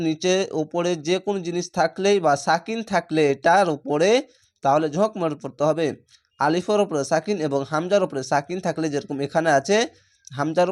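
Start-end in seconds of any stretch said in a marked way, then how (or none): none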